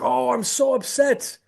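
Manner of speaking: high, loud whiny panic voice; hammed-up high loud distress; mock panicked leader. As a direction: squeal it like a leader in a tizzy. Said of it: "they're like".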